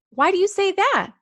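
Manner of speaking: put-on high, bright-eyed child asking; curious kid voice. Classmate gently asking the new girl why. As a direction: lift it up like a caring kid.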